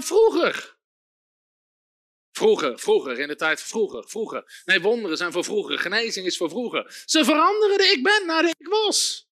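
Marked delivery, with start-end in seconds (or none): 2.3-7.0 much lower, soft mock lament of the old days; a low sighing voice of people stuck in yesterday; mocking the nostalgic. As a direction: parody believers sighing for the past.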